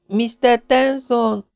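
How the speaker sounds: high, long-drawn call. A narrator's reading. Maisie calling high for Tenson in the dark. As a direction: stretch the name out as you call. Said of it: "kiáltotta".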